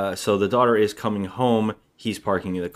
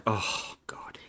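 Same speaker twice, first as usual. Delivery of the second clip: hushed, whispered aside of exasperation; mock exasperated sigh; 8 dB quieter than usual. Imitating the mother watching her daughter, exasperated whisper. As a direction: whisper it as an imitated weary groan.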